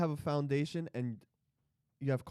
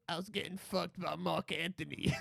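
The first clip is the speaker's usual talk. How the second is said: mock J.Lo accent; 8 dB quieter than usual. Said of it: "I don't know why she has that accent".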